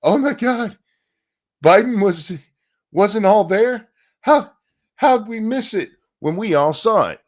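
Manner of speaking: high, loud, mocking disbelief; mock outrage. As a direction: ham up the shocked public. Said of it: "all of us sitting at home going".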